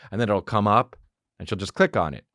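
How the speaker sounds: ordinary talk, nothing marked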